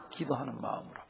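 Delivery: 기도하는 마음으로 said softly and low, falling; soft answer. Shown as a demonstration. Pastor quietly giving the answer before the congregation repeats it, soft. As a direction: hand the answer over quietly. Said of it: "뭐라고요?".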